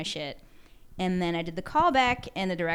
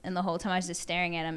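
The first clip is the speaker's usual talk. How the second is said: normal talking voice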